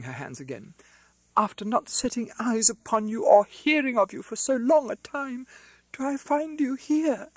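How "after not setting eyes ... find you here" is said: much higher and louder, breathless with shock; a sister spotting her long-lost brother; high, ringing cry of joy; overjoyed cry. A narrator's reading. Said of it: "cried Miss Pross".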